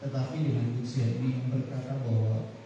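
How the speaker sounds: low, hushed praying voice; prayer. Acted. Pastor leads opening prayer.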